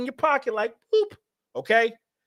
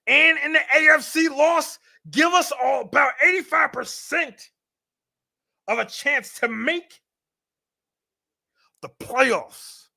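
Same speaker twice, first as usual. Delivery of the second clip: put-on hype voice; 7 semitones higher than usual. Streamer to chat, hammed-up hype voice. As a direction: ham up the playoff odds like a sportscaster. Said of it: "I don't know why I'm talking like that".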